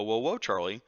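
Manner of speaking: ordinary talk, nothing marked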